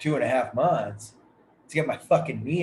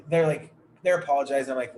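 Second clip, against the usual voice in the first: usual speaking voice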